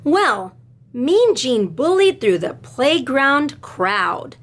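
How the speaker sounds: slow, sing-song read-aloud; reading to children. A narrator's reading. Teacher reading a picture book to her class, sing-song. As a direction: read it with rhythm for little listeners.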